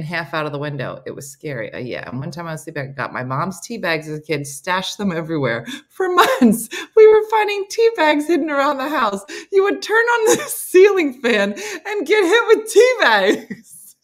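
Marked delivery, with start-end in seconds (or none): 5.9-14.0 high, loud telling through laughter; laughing. Real, not acted. Telling of tea bags hidden around the house, laughing. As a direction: crack up while telling it. Tea bag story cracks speaker up.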